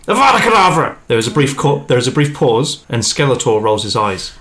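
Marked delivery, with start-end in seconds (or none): 0.1-0.9 high, loud villain curse; shouted curse. Acted. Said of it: "that should have been more hissy".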